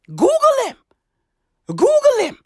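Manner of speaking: high, urgent call to google; excited. Real, not acted. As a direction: insist excitedly. Urging listeners, high excited.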